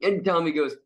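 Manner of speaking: normal talking voice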